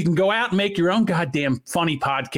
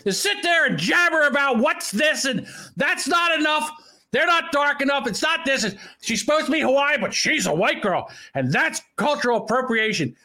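angry rant; 6 semitones higher than usual. Real, not acted. Angry rant at online critics.

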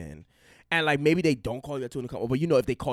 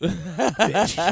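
laughing; 3 semitones higher, 7 dB louder than usual. Real, not acted. Laughing hard.

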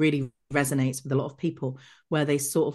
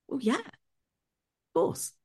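higher, slow, wide-eyed "of course"; naive certainty; 11 semitones higher, 3 dB quieter, much slower than usual. Retold or imitated. Answering her boss about the papers, naively sure. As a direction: play her younger self, innocently sure.

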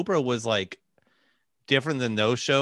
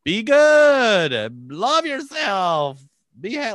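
high, drawn-out show-host shriek; hammed-up Oprah-style exultant cry; mock shriek; 9 semitones higher, 5 dB louder, much slower than usual. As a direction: imitate shrieking positivity, stretching the words out. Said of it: "she was just, like, shrieking".

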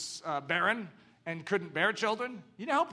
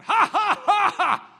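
high, loud mock laughter; a big hearty ha ha ha; high playful put-on laugh; 12 semitones higher, 10 dB louder, slower than usual. Shown as a demonstration. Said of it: "sort of like going".

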